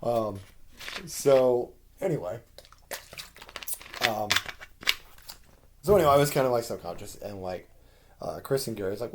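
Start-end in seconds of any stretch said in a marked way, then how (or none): none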